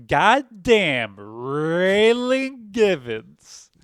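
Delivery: slow, drawn-out Southern god damn, Raylan Givens; Walton Goggins Southern accent. Retold or imitated. Correcting the line order as Walton Goggins, slow and Southern. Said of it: "usually he says the goddamn first".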